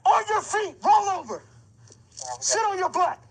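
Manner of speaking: loud, high, raw shouting of orders; furious yelling. Real, not acted. Officer screaming orders at the arrested woman, furious. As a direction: scream every command, voice cracking.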